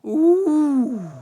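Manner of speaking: high, loud delighted ooh; sudden excited ooh. Real, not acted. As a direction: squeal of delight. Excited exclamation.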